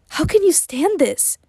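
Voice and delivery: high, slow, pained disbelief; anguished question. A narrator's reading. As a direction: ask it in pain, bewildered. Hurt friend's anguished question.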